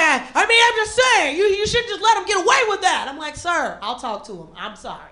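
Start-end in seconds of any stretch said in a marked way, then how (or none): 0.0-3.1 high, fussy mock scolding. Offended man lectures the child's guardian, mock indignant. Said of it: "He was like". 3.4-5.1 low, soft placating tone; meek apology. Retold or imitated. Guardian soothes the irate stranger, soft apology.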